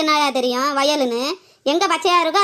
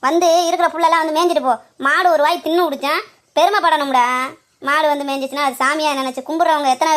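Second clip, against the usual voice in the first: louder, heated scolding; angry rant; 3 dB louder than usual. Acted. Woman scolding the landowner over her cow, angry loud rant. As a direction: tear into him, voice raised.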